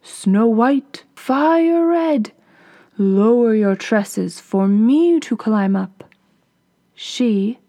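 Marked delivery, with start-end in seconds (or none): 0.0-6.0 lightly voiced prince calling up. A narrator's reading. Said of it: "and cried".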